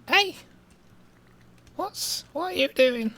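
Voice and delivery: very high, cracking Cannock voice; Cannock accent. Shown as a demonstration. Streamer mocking his local accent, very high cracking Cannock voice. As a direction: squeak it in exaggerated Cannock, voice cracking. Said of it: "that mostly consists of going".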